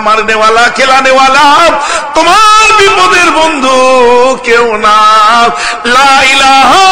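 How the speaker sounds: high, loud, half-sung preaching; fiery preaching. Real, not acted. Preacher exhorting the crowd, loud sung preaching. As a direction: build to a chanting climax.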